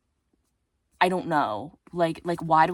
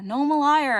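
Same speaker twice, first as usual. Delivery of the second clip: "liar" higher and louder, playful self-accusation; louder mock confession; 9 semitones higher, 7 dB louder than usual. Real, not acted. Admitting clickbait to viewers, loud playful confession. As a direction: own it loudly, teasing.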